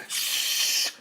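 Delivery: loud, urgent shush. A narrator's reading. Dog silences the shouting cockerel, loud shush. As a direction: hush someone forcefully. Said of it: "said the dog".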